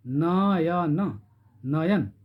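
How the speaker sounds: नयन drawn out very slowly, each syllable held; stretched slow demo. Shown as a demonstration. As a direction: stretch नयन so the hidden अ is heard. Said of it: "नयन, न में अ छिपा हुआ है". (नयन को बहुत धीरे खींचकर बोलना)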